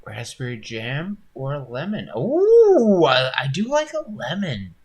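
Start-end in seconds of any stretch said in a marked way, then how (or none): 2.2-4.7 high squealing ooh, louder, then pleased; light up at the flavour; delighted ooh. Real, not acted. Reacting to a chat donut suggestion.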